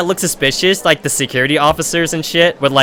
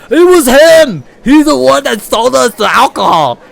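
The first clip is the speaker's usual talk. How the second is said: much higher, loud yelling accusation; angry accusing shout; 9 semitones higher, 6 dB louder than usual. Retold or imitated. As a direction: point the finger and yell. Jock ratting him out.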